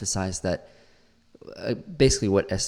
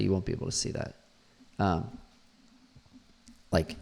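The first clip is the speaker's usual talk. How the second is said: ordinary talk, nothing marked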